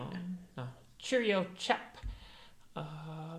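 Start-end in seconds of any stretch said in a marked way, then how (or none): 1.0-2.0 high, drawn-out mock British lilt; British accent. Friend trying the accent, high slow British accent. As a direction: stretch it out in a put-on British accent. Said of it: "like, a British accent".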